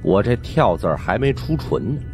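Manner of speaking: ordinary delivery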